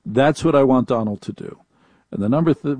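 ordinary talk, nothing marked

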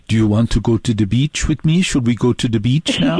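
lightly voiced French guy asking a woman out. French guy asks a woman at work to the beach, lightly imitated. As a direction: imitate a Frenchman's casual invitation. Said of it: "he'll look at her and go".

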